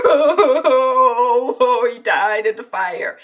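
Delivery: loud, hammed-up sobbing; mock wailing. Family watching the house burn, mock crying. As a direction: imitate a grieving family's wail. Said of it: "they're all screaming and crying".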